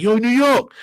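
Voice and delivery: high, loud shout-out; hyped street greeting. Retold or imitated. Stevie greeting him on the block, high and loud. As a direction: hail him across the street. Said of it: "He'd be like".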